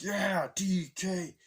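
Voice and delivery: slow, high stretched-out cheer; mock crowd holler; imitated fan cheer. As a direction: draw out the name like a chanting fan.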